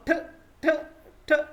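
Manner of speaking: ठ said as a short, sharp t, repeated; short t sound. Shown as a demonstration. Teacher drills the ending sound for students, short and sharp. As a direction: snap out ठ like a clipped t. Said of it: "छोटी सी आवाज़ देते हैं".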